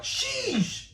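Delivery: high exasperated parent sheesh; parent's exasperated sheesh. Retold or imitated. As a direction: sheesh like a parent avoiding a cuss. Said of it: "your mom or dad ... they're like".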